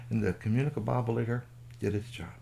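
ordinary delivery

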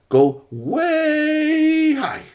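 high, very slow rising with the arm; slow high long way high; stretching voice. A narrator's reading. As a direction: let the voice climb like the arm. Arm extends in story.

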